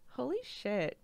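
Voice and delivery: usual speaking voice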